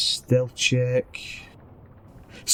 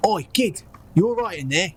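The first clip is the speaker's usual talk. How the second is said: higher-pitched Cockney call; Cockney accent; 7 semitones higher than usual. Acted. Petey calling to someone behind the door, Cockney accent. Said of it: "do his best Cockney accent".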